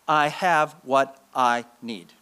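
slow and deliberate; slow creed. Shown as a demonstration. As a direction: press each word home. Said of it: "Five words".

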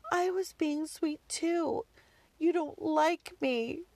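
high, slow, wounded kid imitation; hurt child. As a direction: pout it like a slighted little boy. Hurt son, then started crying.

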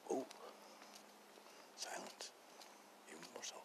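barely audible low whisper; hushed silence. Pretend. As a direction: let the voice fade to almost nothing. Acting out growing silence.

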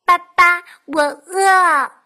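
put-on loud whining baby duckling; hungry duckling. A narrator's reading. Duckling tells the fox it is hungry, calling loudly. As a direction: plead loudly in a little duckling voice. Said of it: "小鸭子说".

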